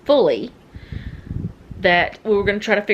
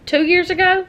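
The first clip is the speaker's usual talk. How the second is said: much higher, louder rising question; calling out; 11 semitones higher, 5 dB louder than usual. Real, not acted. Asking Noah when the alarm went in, calling out. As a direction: call the question across the house.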